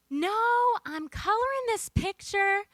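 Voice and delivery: high, lightly acted grandmother voice; imitated grandmother. Elderly grandmother telling about her coloring book, high imitated voice. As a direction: lift it high as an enthused grandmother. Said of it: "And she says".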